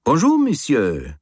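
high, slow, cheerful host greeting; beaming French landlord. A narrator's reading. Herr Rouve beams a friendly greeting at his lodger. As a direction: greet him with a broad friendly beam.

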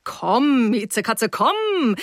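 playful, lightly acted tomcat come-on; mock-seductive calling, last word swooping up high; gallant predator. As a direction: coax her like a would-be beast of prey. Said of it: "spielte das galante Raubtier".

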